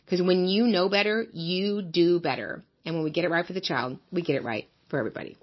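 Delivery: polished promo voice over the show opener; podcast intro read. Acted. Host pitching the podcast to listeners, upbeat promo read. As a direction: sell the show like a radio promo.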